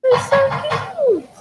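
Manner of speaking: high, slow, gushing coo. Real, not acted. Friend gushing at a puppy on video call, high slow coo. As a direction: melt over the puppy, drawn out.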